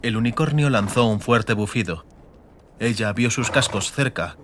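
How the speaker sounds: usual speaking voice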